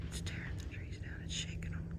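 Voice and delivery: barely audible whisper; faint breathless frightened whisper; terrified whisper. Real, not acted. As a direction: whisper so it won't hear you. Creature tearing trees nearby.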